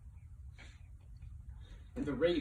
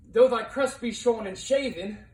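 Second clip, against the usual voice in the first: louder and higher, flung at the bird; a raised declamatory challenge; defiant outcry; 9 semitones higher, 11 dB louder than usual. Acted. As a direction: call it out boldly to the raven. Narrator cries out to the raven.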